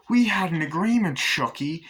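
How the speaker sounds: acted angry reproach; mock indignant. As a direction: act out a wife confronting her husband. Acts Tiffany arguing with Chucky.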